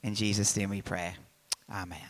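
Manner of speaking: opening prayer. Real, not acted. Host prays aloud to thank God for the morning, prayerful.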